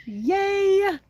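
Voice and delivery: high squeal of delight; excited cheer. Real, not acted. Vlogger greeting a viewer who joined, squealing yay. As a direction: squeal yay at a friend tuning in.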